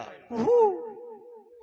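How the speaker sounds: high, drawn-out koel cry kuhu; koel call. Retold or imitated. Preacher mimics the phone ringtone in a long, high koel call. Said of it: "लगेच mobile काढला".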